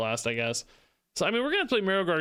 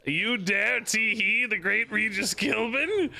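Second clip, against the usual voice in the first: slow, grand, hammed-up indignation; mock pompous outrage; 5 semitones higher, slower than usual. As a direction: play an offended champion, slow and proud. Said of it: "competitive underlying manliness".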